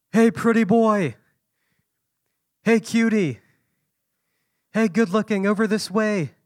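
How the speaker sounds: high, loud, teasing catcall voice. Retold or imitated. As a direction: call out like flirty bar women on the street. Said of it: "They were whistling at me".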